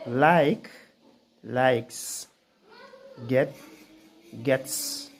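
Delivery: "likes", "gets", "sleeps" ending in a crisp hissed "s"; dictionary pronunciation. Shown as a demonstration. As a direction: read each word slowly, ending in a hissed "s". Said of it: "the sound S".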